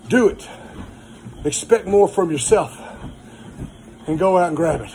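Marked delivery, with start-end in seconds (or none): none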